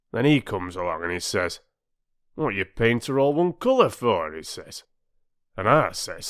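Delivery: aggrieved working-class bargee, lightly voiced; an old bargeman airing his grudge to his mates; bargee's grumbling tale. A narrator's reading. Bargee Bill telling his grievance.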